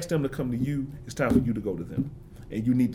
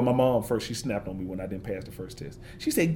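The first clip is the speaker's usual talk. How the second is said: usual speaking voice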